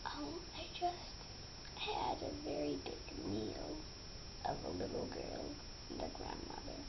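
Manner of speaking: secret breathy whisper, no voice; wolf whispering his secret to the man. Acted. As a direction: whisper it like a sly confession. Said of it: "in a whispering voice".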